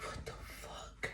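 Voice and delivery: slow, drawn-out voiceless whisper; stunned, hushed mock reaction; whispered shock. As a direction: whisper it slowly in disbelief. Shocked at the tire price.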